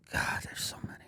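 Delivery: breathy whisper, almost to himself; whispered aside. Real, not acted. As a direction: let it slip out under the breath. Overwhelmed remembering brother.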